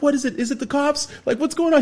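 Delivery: high, jittery alarm; groggy roommates, alarmed; panicky questions. Retold or imitated. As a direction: fear the cops are here. Roommates panicking about cops.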